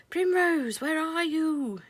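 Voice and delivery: high, loud searching call; cried out. A narrator's reading. Mister Apple calls for Primrose, high loud cry. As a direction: shout for the lost child. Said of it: "he cried".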